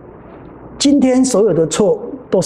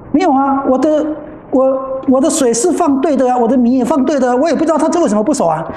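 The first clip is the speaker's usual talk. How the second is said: higher, whiny insistence, fast at the end; defensive protest; 7 semitones higher than usual. Retold or imitated. Monk insists to the teacher his water was right, defensive and high. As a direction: protest your innocence, pitched up.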